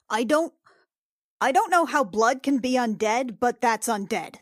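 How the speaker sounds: ordinary talk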